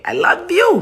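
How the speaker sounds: high, rising affectionate sign-off; playful, gushing. Real, not acted. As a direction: send it out brightly with a big upward lift. Playful louder repeat at sign-off.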